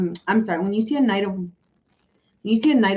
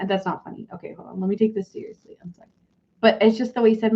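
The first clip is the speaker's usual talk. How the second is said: normal talking voice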